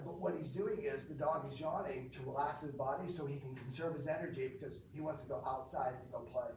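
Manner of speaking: normal talking voice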